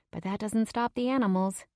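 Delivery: ordinary delivery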